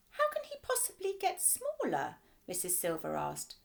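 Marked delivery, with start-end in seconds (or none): 0.1-2.2 high, curious lady's question. A narrator's reading. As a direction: lift it into her bright voice. Mrs Silver asks, high voice.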